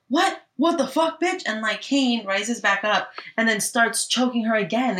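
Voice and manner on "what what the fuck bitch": mock outrage.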